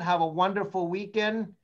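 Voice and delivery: ordinary delivery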